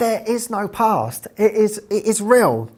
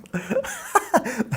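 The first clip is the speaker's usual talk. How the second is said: laugh; 5 semitones higher, 4 dB quieter than usual. Real, not acted. Laughs at own doubt.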